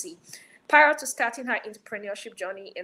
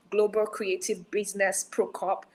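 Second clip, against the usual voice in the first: normal talking voice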